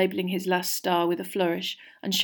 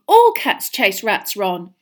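upset, raised-voice pleading; voice shaking; 8 dB louder, slower than usual. A narrator's reading. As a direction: argue back with a slight tremble. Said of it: "her voice shaking".

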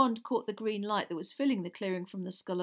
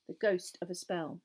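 ordinary delivery

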